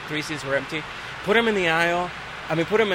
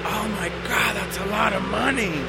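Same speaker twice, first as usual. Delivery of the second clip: awed guy; 4 semitones higher than usual. Retold or imitated. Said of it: "He's like".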